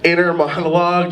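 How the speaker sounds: ordinary delivery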